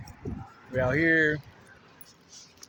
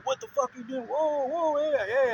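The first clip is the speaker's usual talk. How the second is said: mocking freak-out; 12 semitones higher than usual. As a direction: squeal it like he's losing it.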